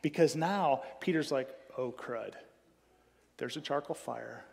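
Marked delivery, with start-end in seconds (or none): 1.8-4.5 mock whisper. Peter spotting the charcoal fire.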